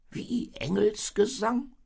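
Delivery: high, slow, loud baffled question; a sheep taken aback, voice shooting up. A narrator's reading. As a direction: ask it in high-pitched disbelief. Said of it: "fragte das Schaf verblüfft".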